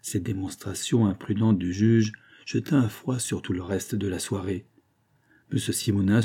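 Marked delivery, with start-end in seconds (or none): none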